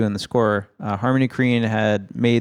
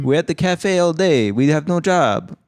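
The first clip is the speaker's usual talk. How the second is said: mock French bohemian; 5 semitones higher than usual. Mocking lazy Parisians.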